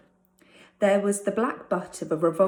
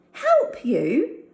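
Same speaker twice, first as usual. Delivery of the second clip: louder, disbelieving rise; almost shouting; 7 semitones higher, 6 dB louder than usual. Acted. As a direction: flung back in outrage. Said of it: "David was almost shouting".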